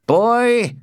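high, loud challenging shout. A narrator's reading. Tybalt stops Romeo as he leaves, shouting. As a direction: shout it after a man walking off. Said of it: "shouted Tybalt".

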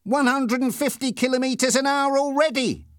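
high, excited shouting; excited cry. A narrator's reading. Passenger calling the speed to the driver, excited cry. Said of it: "cried my passenger".

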